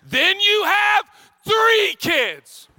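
high, drawn-out comic scream; exasperated shout. Real, not acted. Worn-out parent reaching three kids, exasperated shouting. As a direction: yell it as the breaking point. Escalating list of parental stress.

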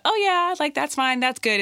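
high breezy fake-nice voice; sing-song mock approval; fake agreeable. Mocking a falsely agreeable partner.

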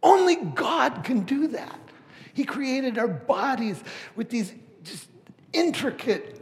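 loud, high, ringing sermon voice; fiery pulpit delivery at the top of the voice; full-force preaching. Acted. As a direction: preach it out to the whole room.

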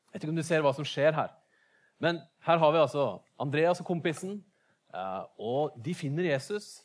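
normal talking voice

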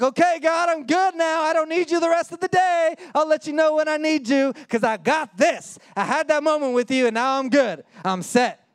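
high, loud, breezy brush-off of God; smug dismissal. Retold or imitated. Believer telling God he's not needed today, smug and breezy. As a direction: wave God off like you've got it handled. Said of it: "we go out and we're like".

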